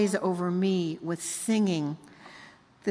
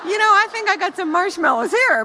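much higher and louder, giggly; laughing reply; 10 semitones higher, 8 dB louder than usual. Retold or imitated. Grandmother answering granddaughter on phone, laughing high. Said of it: "and I went".

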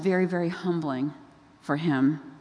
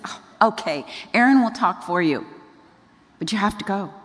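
mildly exasperated concession, slightly raised; a little disgusted; 2 semitones higher, 3 dB louder than usual. Retold or imitated. God relents to reluctant Moses, a little disgusted. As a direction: give in with mock impatience. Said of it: "God's a little disgusted with him".

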